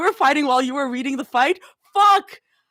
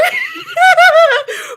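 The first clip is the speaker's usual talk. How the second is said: loud, very high burst of laughter; explosive high cackle; shrieking laugh; 9 dB louder than usual. Real, not acted. Cracking up.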